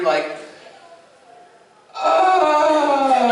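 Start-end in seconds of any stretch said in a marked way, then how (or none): 1.9-3.3 high, loud mock moan; hammed-up porn moan; imitated moan. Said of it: "you hear".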